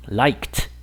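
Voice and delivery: LIKED as one syllable, "likt"; correct T ending. Shown as a demonstration. Teacher modelling the voiceless ending, liked as "likt". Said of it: "va a sonar más bien como una t".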